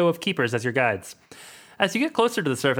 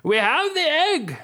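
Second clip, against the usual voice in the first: shouting; 12 semitones higher, slower than usual. Acted. Leader shouts with egg.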